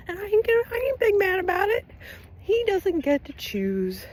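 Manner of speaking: very high, squeaky mock-crying voice; high-pitched teasing singsong; mock whine. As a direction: squeal it in a playful, fake whimper. Mocks the idea of being mad.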